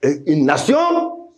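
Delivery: high, ringing preacher's cry; full-force preaching. Real, not acted. Preacher declaring God's word to the assembly, full-force preaching. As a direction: proclaim it high to the whole assembly.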